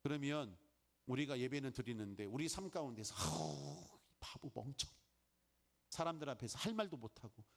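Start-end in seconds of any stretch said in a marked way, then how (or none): 3.2-4.9 low groan then calling yourself a fool; mock self-insult, muttered; lightly voiced self-blame; self-scolding. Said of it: "내가 나 자신한테".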